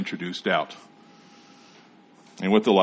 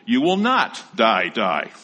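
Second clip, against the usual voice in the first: slow, raised NOT, then die dropping away; emphatic denial; 2 semitones higher, 4 dB louder, slower than usual. Acted. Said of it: "the serpent used the emphatic".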